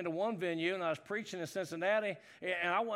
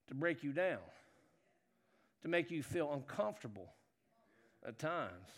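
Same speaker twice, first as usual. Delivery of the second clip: hushed; 7 semitones lower, 8 dB quieter than usual. Acted. Voice drops low and soft.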